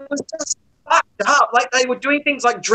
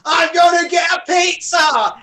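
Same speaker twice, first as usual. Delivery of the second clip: put-on drunk guy; 7 semitones higher, slower than usual. Drunk lad shouting to his mates about pizza. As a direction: bellow it like a drunk lad on a night out. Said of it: "he goes".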